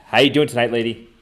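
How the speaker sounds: usual speaking voice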